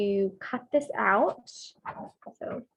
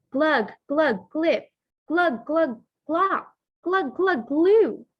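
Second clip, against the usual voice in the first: gurgling swamp noises; 5 semitones higher, 4 dB louder, slower than usual. Acted.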